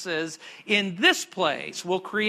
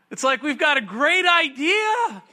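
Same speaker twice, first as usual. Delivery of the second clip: 1 mock excitement; 9 semitones higher, 5 dB louder than usual. Mocking the people's idea.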